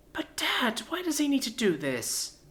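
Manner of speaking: a worried daughter cutting in; young daughter. Acted. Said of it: "Morticia interjected".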